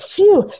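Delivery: high, breathy sigh of relief; light acted relief; relieved phew. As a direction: blow out a mock sigh of relief. Relief after storm, acted.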